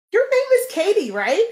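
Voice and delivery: high bright mock sorority girl voice with a laugh.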